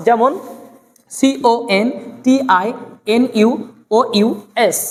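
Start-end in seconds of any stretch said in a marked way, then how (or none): none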